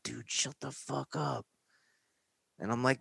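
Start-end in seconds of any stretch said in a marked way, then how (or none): 0.0-1.5 soft, slow urgent hush; friend hushing. Retold or imitated. Friend hushing him during the stop, soft and slow. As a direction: hiss at your buddy to stop.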